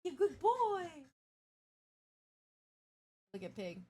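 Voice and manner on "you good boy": much higher, louder praise for the dog; high pet talk. Real, not acted. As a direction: cheer him on like a proud owner.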